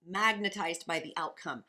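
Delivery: ordinary delivery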